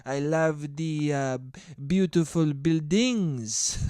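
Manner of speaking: put-on slow, halting French accent; drawn-out bad French accent. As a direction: do a terrible French tourist accent. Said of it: "I'm fucking terrible at French accents".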